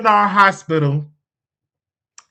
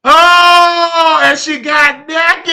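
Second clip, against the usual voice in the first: loud, high, slow cry of disbelief; half-crying in shock at what happened; 7 semitones higher, 8 dB louder, much slower than usual. Real, not acted. Vlogger reacting to the woman stripping, shocked slow cry.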